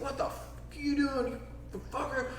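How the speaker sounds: high, strained mock rage; furious threat. [name] yelling at the kid who dropped in on him, high angry snarl. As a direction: snarl the threat like an enraged local.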